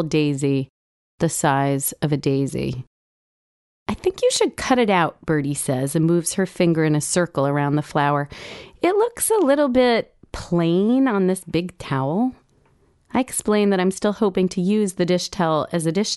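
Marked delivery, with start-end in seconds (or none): 3.9-5.2 put-on higher child voice; little girl voice. Daughter Birdie voiced. 8.8-12.3 put-on little girl voice. Daughter Birdie voiced.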